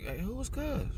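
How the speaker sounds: high, soft mock caller; soft high-pitched imitation of someone asking; imitated caller. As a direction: mimic a puzzled relative, high and hushed. Mimics relatives asking about the dude.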